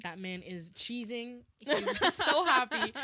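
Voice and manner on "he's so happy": laughing. Real, not acted. Amused story about dad.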